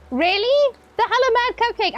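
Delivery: a gasp then a high, bright burst of surprise; mock surprise. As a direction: act amazed at the name. Gasping at a streamer's name.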